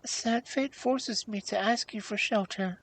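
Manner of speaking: high, mildly tearful plea; tearful princess. A narrator's reading. Princess asking the hag for shelter, mildly tearful. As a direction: plead lightly, a touch of tears. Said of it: "with tears in her pretty eyes".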